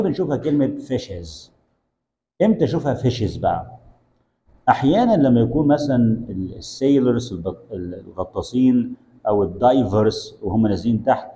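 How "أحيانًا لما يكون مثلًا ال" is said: normal talking voice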